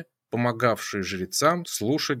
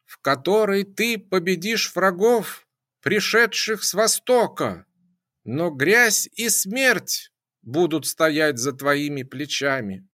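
shrieking; 6 semitones higher than usual. A narrator's reading. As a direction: keep shrieking the prophecy. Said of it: "взвизгнула Пифия".

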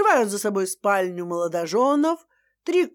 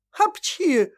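громкий наигранный чих; чихнуть резко; 7 semitones higher than usual. Acted. Чих свекрови. (чих свекрови)

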